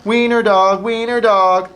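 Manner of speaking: high, loud sing-song jeer; laughing taunt. A narrator's reading. Dogs running off, high taunting. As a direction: tease him as they run. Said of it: "laughed the other dogs".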